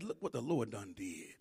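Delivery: low and soft, almost to himself; soft lead-in to the shout; quiet. Shown as a demonstration. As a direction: hush it low before the build. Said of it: "Can I say it like this?".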